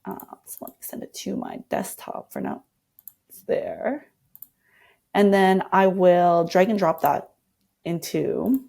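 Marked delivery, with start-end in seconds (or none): 0.0-4.1 breathy whisper to herself while working; half-whispered note to self; whispered aside. Real, not acted. Designer muttering to herself while saving the file, whispered.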